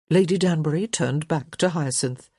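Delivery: plain reading voice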